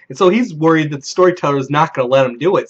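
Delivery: usual speaking voice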